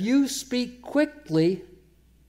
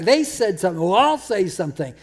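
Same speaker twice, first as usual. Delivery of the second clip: imitating a petty angry comeback, louder; mock angry retort growing louder; mock tit-for-tat retort; 5 semitones higher, 6 dB louder than usual. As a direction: play the person firing back, building louder and higher. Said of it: "by you coming back".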